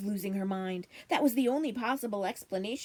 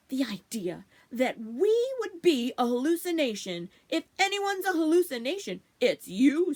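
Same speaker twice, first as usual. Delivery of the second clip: indignant; 4 semitones higher, 5 dB louder than usual. Acted. Mister Bunny indignantly retorting.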